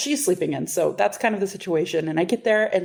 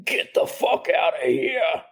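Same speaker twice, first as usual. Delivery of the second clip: deepest, grossest voice; slower than usual. Retold or imitated. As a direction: growl it out as gross as you can. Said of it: "like, the deepest, grossest voice I could muster".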